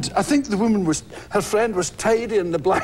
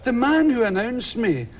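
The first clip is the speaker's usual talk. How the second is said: normal talking voice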